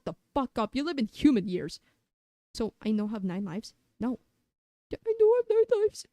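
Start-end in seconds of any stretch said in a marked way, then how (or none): 4.9-6.1 goofy high question. Acted.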